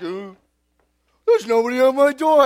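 lightly hammed-up neighbour at the door; puzzled neighbour. Pranked homeowner finding nobody at the door, mock puzzlement.

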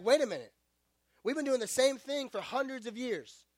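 indignant Saul. Acted. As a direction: rant like a zealot vowing to wipe them out. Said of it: "and he's like".

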